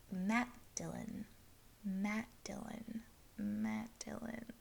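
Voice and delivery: slow, very soft repetition; pondering murmur. Retold or imitated. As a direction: murmur it to yourself, trying to place it. Puzzling over a familiar name.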